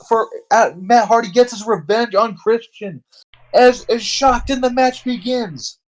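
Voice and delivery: high, shouted play-by-play; excited shock. Real, not acted. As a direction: call the ambush like a stunned ringside announcer.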